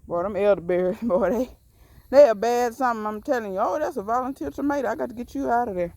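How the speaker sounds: normal talking voice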